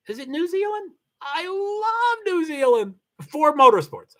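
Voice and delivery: light up with real joy over New Zealand; delighted high voice. Real, not acted. Excited about reader's country.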